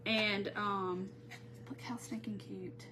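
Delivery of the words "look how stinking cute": whispered, delighted; excited whisper. Real, not acted. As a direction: breathe it out like a secret delight. Admiring fabric on camera.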